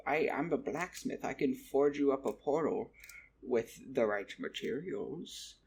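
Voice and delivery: measured goblin voice, slowing at the end; hint at a solution as a goblin craftsman; goblin blacksmith. Retold or imitated. Goblin blacksmith offering a portal home, put-on character voice. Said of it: "and he went".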